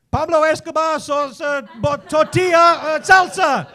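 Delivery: high, loud imitation of Spanish; mock Spanish speaker. Deli lady to supposed countryman, loud mock Spanish. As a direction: fire out loud mock-Spanish words, haltingly.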